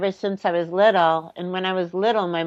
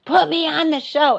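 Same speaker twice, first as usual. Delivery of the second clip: retold scream; 9 semitones higher than usual. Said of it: "I stood up and I screamed".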